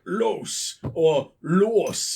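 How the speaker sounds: "los" drawn out with an odd vowel; slow mimicry of a mispronounced "los"; imitated weird pronunciation. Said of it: "why anyone would pronounce it like that".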